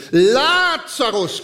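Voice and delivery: high, long-drawn call; calling by name. Acted. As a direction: call out one name, stretching the first syllable. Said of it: "so hat er nur Lazarus gerufen".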